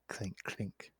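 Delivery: soft unvoiced clink sounds; soft clink noises. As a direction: whisper the clinks softly. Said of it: "That's a very soft clink".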